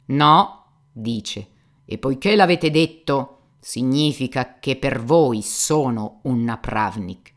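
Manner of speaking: loud, offended official reply; lightly voiced stiff official; a hint of a stern police chief. The police chief coldly rebuffs Fyodor, loudly.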